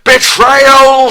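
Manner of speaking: very high, loud roaring shout; furious shout. Acted. Prince sees the cat romance Kate, furious roaring shout. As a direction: scream betrayal with full fury. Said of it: "shouted Prince Harry with snarling face".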